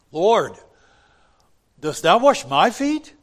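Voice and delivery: high, loud disbelieving question; incredulous Peter. Retold or imitated. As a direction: Peter aghast at the Lord washing his feet. Peter protesting in sermon retelling.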